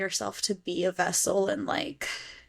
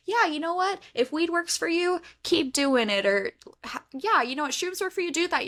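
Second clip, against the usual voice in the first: high-pitched, casual mock approval; easygoing doctor; 9 semitones higher than usual. Up-to-date doctor okaying a patient's remedy, easygoing and high-pitched. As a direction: imitate an agreeable doctor, pitch well up.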